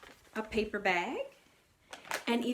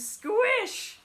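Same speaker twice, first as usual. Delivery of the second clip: high, squeaky squish; comic high squelch sound; squish noise; 11 semitones higher, 6 dB louder than usual. Acted. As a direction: make the squelch of stepping in it. Pete steps in mud.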